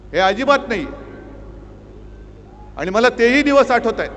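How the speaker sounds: loud, impassioned oratory to the crowd; fiery rally speech. Acted. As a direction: thunder it at a packed rally. Fiery rally attack on government.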